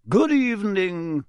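jovial, swooping hello; genial ghost. A narrator's reading. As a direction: greet them grandly, beaming. Beaming ghost greets the children.

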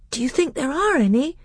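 put-on high, loud, hopeful young voice; eager boy. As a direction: ask with boyish excitement. Boy eagerly asks about survivors.

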